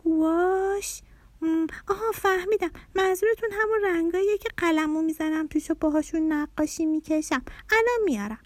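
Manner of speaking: put-on high-pitched child, puzzled then catching on; bright realisation in a little girl's high voice; little daughter. A narrator's reading. Little girl answering dad about her gouache paints, high and eager.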